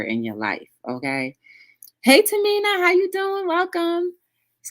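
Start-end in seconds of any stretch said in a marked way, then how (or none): 2.0-4.1 high, bubbly hello; sing-song high welcome; excited greeting. Real, not acted. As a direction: pitch it way up to welcome a friend. Greets a newcomer in chat.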